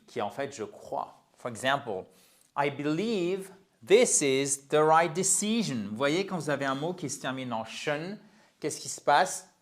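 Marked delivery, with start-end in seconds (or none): 2.6-5.9 slow, careful demonstration for learners; slow model sentence. Shown as a demonstration. Teacher giving English students an example, slow model. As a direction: stretch each word out clearly.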